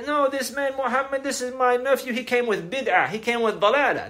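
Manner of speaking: high, scornful voice of the accuser; mocking Abu Lahab. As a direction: sneer like the enemy uncle. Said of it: "what did he say?".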